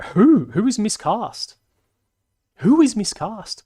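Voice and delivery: indignant disbelief. Real, not acted. Demanding which actor is miscast.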